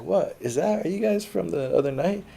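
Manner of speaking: mock surprise. His own confused reply, voiced.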